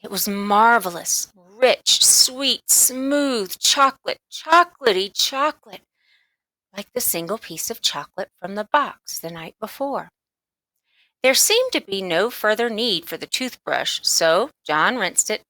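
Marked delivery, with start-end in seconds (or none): none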